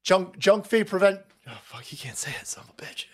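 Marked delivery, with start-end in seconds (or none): 1.5-3.0 breathy whispered curse under the breath; an exasperated whisper aside; muttered whisper. Real, not acted. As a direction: mutter it unvoiced to yourself.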